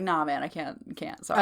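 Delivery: offhand refusal. Retold or imitated. Acts out servant refusing woman.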